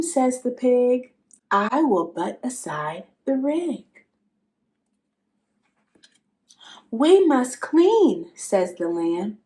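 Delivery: lively, expressive picture-book reading with little animal voices; reading to children. Acted.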